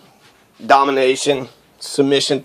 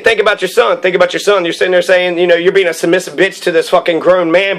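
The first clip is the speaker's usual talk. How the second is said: ordinary delivery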